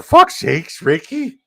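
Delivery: high, slow pleading whine; Bubbles impression. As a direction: beg Ricky like Bubbles. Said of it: "Bubbles, man".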